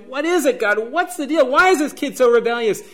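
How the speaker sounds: father pleading with God about his son, exasperated; exasperated plea. Retold or imitated. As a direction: throw the question up in frustration. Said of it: "And I'm going".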